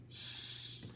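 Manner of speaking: whispered shh; shushing. Acted. Sign saying quiet.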